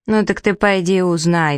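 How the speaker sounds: plain reading voice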